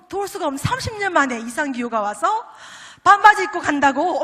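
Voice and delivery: loud, thrilled disbelief; overjoyed retold letter from the daughter; excited daughter. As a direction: burst out with the amazing news.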